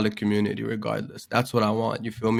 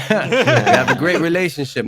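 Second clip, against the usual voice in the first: higher, louder, laughing; 7 semitones higher, 7 dB louder than usual. Real, not acted. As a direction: laugh as you say it. Laughs about loving weed.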